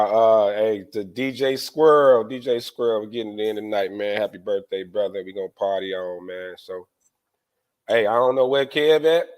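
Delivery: ordinary delivery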